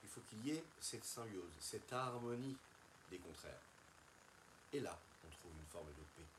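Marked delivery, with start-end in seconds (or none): none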